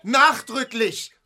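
sehr hoch, langsam und betont; empört hoch beharren, Wort für Wort; nachdrücklich. Acted. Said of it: "ich formuliere nachdrücklich". (nachdrücklich und empört, viel höher)

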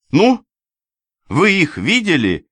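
high, loud, eager question; impatient demand. A narrator's reading. Companion questioning the narrator with flashing eyes, impatient demand. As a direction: press him urgently for an answer.

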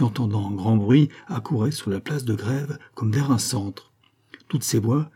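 ordinary delivery

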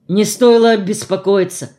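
ordinary delivery

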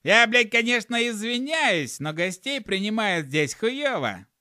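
high, loud gnome shout. A narrator's reading. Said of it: "А затем крикнул".

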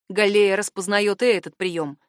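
ordinary delivery

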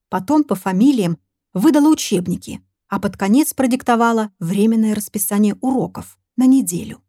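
ordinary delivery, nothing marked

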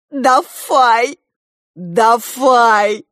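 "Дафай" with f in place of v, loud; imitated mispronunciation. A narrator's reading. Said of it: "как ты говоришь". (громко «Дафай», с «ф» вместо «в»)

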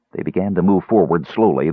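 ordinary delivery, nothing marked

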